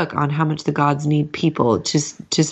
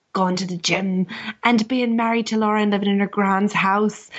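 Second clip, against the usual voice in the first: higher, hammed-up Irish brogue; terrible Irish accent; 6 semitones higher than usual. Co-host losing the thread about Shadow, high terrible Irish accent. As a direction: struggle through a badly faked Irish accent. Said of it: "your terrible Irish accent".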